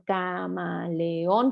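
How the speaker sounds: "camaleón" stretched very slowly; drawn-out word. Shown as a demonstration. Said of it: "decir una palabra camaleón". ("camaleón" alargado y lento)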